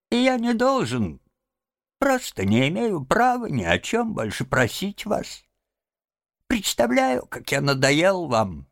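high, feeble invalid voice; plaintive self-pitying Toad; ailing, martyred Toad. A narrator's reading. Sick Toad apologising to Rat, feeble plaintive voice.

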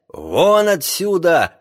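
slow, high, loud dismissal; angry shout. A narrator's reading. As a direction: throw him out with a shout. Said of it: "крикнул капитан".

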